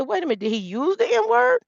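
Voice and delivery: high, rising disbelief; retold shocked question, pitch climbing; incredulous. Questioning her father about the slur, incredulous.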